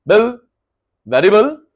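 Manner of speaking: ordinary talk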